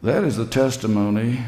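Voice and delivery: ordinary delivery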